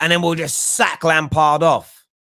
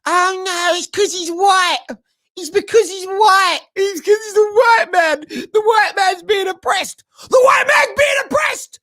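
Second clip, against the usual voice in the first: high, louder whining mock cry; mocking whiny critics; 3 dB louder than usual. Host mocking fans who blame race, in a high whining mock cry. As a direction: whine it like an over-the-top victim. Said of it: "and now people".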